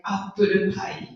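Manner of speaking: flat "apple pie" as appuru pai; Japanese English accent. Shown as a demonstration. Teacher showing the menu word, Japanese English accent. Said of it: "Japanese English is very flat".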